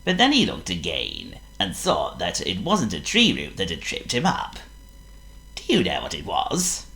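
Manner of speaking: usual speaking voice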